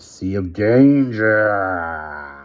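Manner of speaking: a werewolf snarling the name of the sea; no longer human. A narrator's reading. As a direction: growl it out like a wolf. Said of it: "It was no longer a human being speaking".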